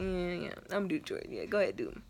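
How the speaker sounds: low, soft muttered agreement; talking herself into it. Retold or imitated. Daughter answers herself about chores, low muttering.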